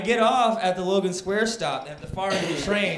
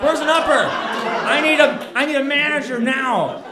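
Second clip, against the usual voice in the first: high, loud demand for a manager; entitled customer yelling for the manager; 8 semitones higher, 6 dB louder than usual. Retold or imitated. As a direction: become that guy who wants the manager. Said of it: "and I become that guy".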